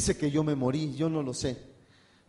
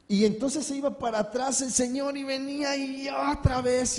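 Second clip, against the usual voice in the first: much higher, shouted storytelling; excited shout; 9 semitones higher than usual. Real, not acted. Preacher telling the congregation of the beating, excited shout. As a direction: burst out with the action.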